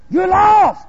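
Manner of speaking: sudden high, loud verdict; accusing shout. Acted. Preacher voicing the verdict on Brother Ed, accusing shout. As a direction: burst out with the accusation.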